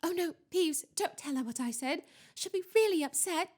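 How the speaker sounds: high, frantic pleading, half-whispered. A narrator's reading. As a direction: plead urgently to stop him telling. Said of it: "Hermione whispered frantically".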